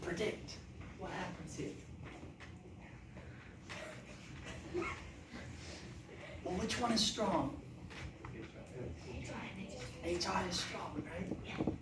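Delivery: soft hushed whisper; worried whisper. Real, not acted. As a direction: keep it hushed and secretive. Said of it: "Why are we whispering?".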